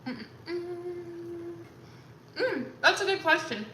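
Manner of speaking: chewing between words, mouth full; eating while talking. Real, not acted. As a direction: mumble through a bite of food. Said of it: "I'm eating".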